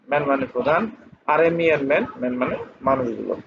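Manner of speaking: usual speaking voice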